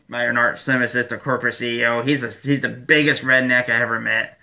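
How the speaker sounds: normal talking voice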